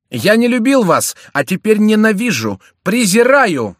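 very high, loud outburst; hateful cry. A narrator's reading. Шомберг declares hatred to his enemy, furious cry. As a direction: cry out your hatred at the top of the voice. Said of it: "вскричал Шомберг".

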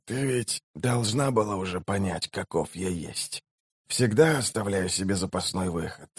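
very soft, weakened man's voice; dying mage, knowing. A narrator's reading. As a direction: explain it quietly with failing strength. (тихий, слабеющий голос умирающего мага)

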